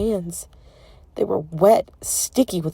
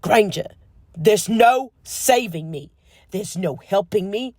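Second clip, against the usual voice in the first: exasperated outburst; 3 semitones higher, 5 dB louder than usual. A narrator's reading. Draco exasperated.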